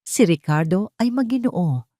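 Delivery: ordinary delivery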